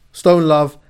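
normal talking voice

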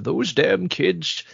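put-on slow, high creaky old man voice. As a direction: grumble it like a cranky old codger. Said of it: "the old man voice of".

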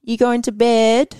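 "bed" stretched almost into two syllables; slow, rising question with a drawn-out two-part vowel on "bed"; rural Victorian pronunciation. Shown as a demonstration. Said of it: "bed almost into two syllables".

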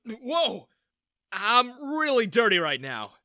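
high, loud, drawn-out alarm; exclaiming. A narrator's reading. Filthy young man hugged without warning, exclaiming. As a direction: blurt it out, startled. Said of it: "I exclaimed".

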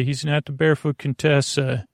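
ordinary talk

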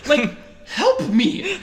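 pleading with mom in disbelief; incredulous. Retold or imitated. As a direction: plead in disbelief while laughing. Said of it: "and I'm like".

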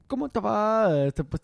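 clumsy French accent imitation that slips toward Chinese; botched foreign accent. Shown as a demonstration. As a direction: put on a lousy little foreign accent. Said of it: "je lui réponds avec un petit accent dégueulasse".

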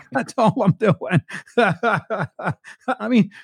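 chuckling through the words, slowed; laughing. Real, not acted. Host laughing at his own accent story, amused. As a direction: laugh it off as you say it.

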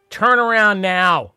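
loud, slow warning; stern command. Acted. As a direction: bark it like a border guard. Ordering intruders to leave.

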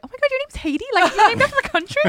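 high-pitched, gushing mimicry of astonishment; exaggerated surprised squeal of a question; mock amazement. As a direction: imitate someone amazed, pitch way up. Mimics people mishearing her name.

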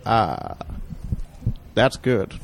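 low, slow, savoring impersonation; Morgan Freeman. Acted. Friends beg for stoned Morgan Freeman, low slow impression. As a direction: drawl it low, as if blissfully stoned. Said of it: "No, do it".

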